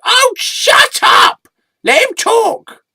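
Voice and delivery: very high, drawn-out shouted order; loud squeaky scolding. Acted. Sidekick tells interviewer to let him talk, squealed scolding. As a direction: snap it in a piercing high voice. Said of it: "why just speak so loud".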